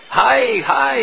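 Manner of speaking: high, alarmed little cry of dismay. Acted. Brahmin seeing the boy eat his offering, high dismayed cry. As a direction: yelp in shock at the ruined offering. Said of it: "the Brahmin said in Bengali".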